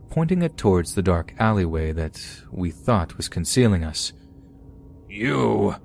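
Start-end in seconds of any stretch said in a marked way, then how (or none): 5.1-5.7 put-on rough Scottish accent, roared. A narrator's reading. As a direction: drunk Scot bellowing. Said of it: "he roared in his rough Scottish accent".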